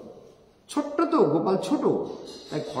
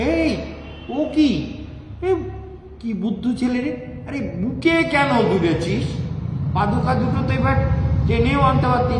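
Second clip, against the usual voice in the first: higher, louder mock scolding of a silly son; fond scolding father; 7 semitones higher, 5 dB louder than usual. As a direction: tease the boy loudly, like a father who can't believe it. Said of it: "Nanda বাবা তাকিয়ে বলে".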